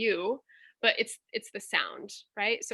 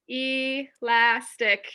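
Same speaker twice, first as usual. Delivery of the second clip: "Elastic" dragged out very slowly; reading a student's word aloud; slow syllables; 2 semitones higher, 4 dB louder, much slower than usual. Shown as a demonstration. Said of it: "thanks, Mel".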